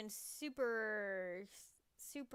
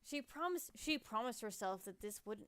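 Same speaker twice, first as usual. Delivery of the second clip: dramatic novel reading; story narration. A narrator's reading. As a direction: read it like a storyteller. Reads visual novel narration.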